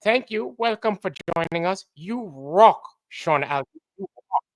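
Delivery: Flatzoid. Retold or imitated. Host greeting a guest as a friend would, put-on voice. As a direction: imitate a friend's welcome in a put-on voice. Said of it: "Flatzoid would say".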